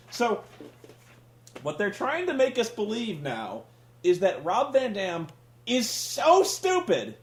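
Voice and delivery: normal talking voice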